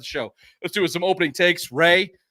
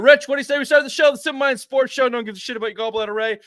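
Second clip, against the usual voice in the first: higher and louder, announcer energy; hyped show opener; 8 semitones higher, 5 dB louder than usual. Acted. Host launching sports show to cohosts, hyped announcer voice.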